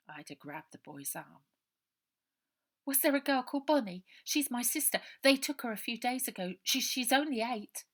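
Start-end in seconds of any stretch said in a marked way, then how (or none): 2.8-7.8 higher, louder, fast worried questions; desperate to find her sister; anxious girl Ida. Acted. Ida pressing the boy about her sister, anxious.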